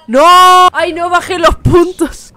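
loud, high, slow panicked cries; panicked shout. Real, not acted. Gamer can't reach his house, panicked loud cries.

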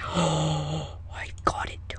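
hushed slow whisper. Real, not acted. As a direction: whisper it in triumph. Sneaky pumpkin grab.

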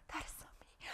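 barely audible whispered thought; whispered aside. Acted. Pitcher reacting inwardly to being told she lost them, whispered. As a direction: whisper it privately to yourself. Acting out her private reaction to critique.

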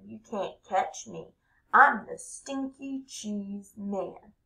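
high, loud bragging cartoon voice; boasting cheese man. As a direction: crow it like a cocky little runaway.

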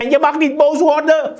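high-pitched, insistent preacher; forceful preaching. Real, not acted. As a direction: drive every warning home to the congregation.

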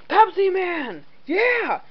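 high yelling battle cry. Acted. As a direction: call out the hero's name. Character calls out attack.

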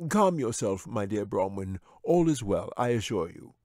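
lightly voiced smooth wizard; a hint of a wizard calming his pet. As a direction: soothe the bear like a sly wizard. Wizard soothes the bear.

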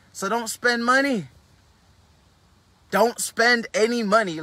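preacher-style insistence; emphatic advice. Real, not acted. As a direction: lay down the rule deliberately. Hammering key advice.

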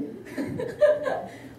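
breathless high-pitched giggle; real giggle after the eager act. Real, not acted. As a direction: giggle at your own bit. Laughing at own act.

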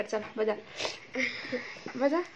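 ordinary delivery

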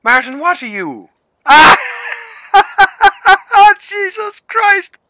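high shrieking disbelief, drawn out, breaking into laughter; stunned squeal then laughter; shocked shriek. Real, not acted. Shocked by a video tagging them.

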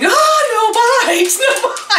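very high, slow, drawn-out squawk with only a little extra volume; screamed parrot echo. Retold or imitated. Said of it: "she started screaming".

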